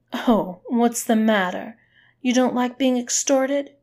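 loud mock concern, pitch climbing. A narrator's reading. Woman taunting the man she extorts, mocking. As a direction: taunt with a loud, rising fake question.

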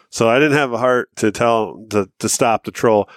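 ordinary delivery

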